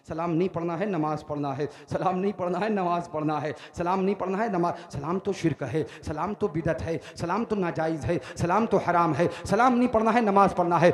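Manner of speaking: rhythmic self-reminder, building in volume; insistent looping recitation that steadily swells; drilled-in repetition. Acted. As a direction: repeat it like a memorized rule. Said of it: "खोपड़ी में fit है".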